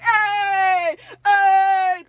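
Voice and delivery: high, loud drawn-out cheer; hammed-up crowd holler; mock party cheer. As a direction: imitate men whooping at thrown money. Men cheering as money is thrown.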